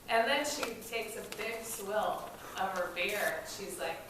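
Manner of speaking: ordinary talk, nothing marked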